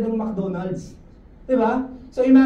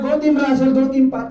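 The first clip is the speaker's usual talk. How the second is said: mock cheer; 5 semitones higher, 7 dB louder than usual. Cheering on the sub-teams.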